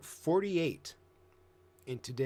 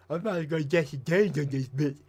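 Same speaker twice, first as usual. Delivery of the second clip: mocking Cyrax's tough talk; 5 dB louder than usual. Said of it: "Can you stop with the weird accent?".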